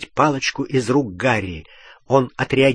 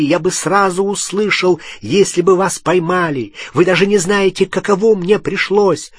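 higher, insistent, mounting protest; heated self-defence; 5 semitones higher, 4 dB louder than usual. Acted. Ron justifying himself to Hermione, heated shouting. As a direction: pour it out, getting more worked up. Рон перекрикивает Гермиону, оправдываясь.

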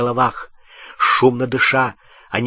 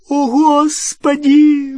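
high startled shriek; 14 semitones higher, 7 dB louder, slower than usual. A narrator's reading. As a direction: shriek in fright. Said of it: "взвизгнула Гермиона".

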